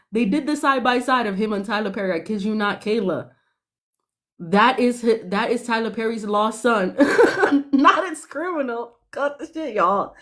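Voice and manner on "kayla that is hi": ordinary talk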